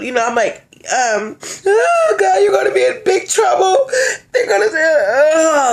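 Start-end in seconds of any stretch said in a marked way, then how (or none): 1.7-5.7 loud, high-pitched mock wail; fake crying. Warning him he's in big trouble, fake crying. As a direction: wail in hammed-up distress.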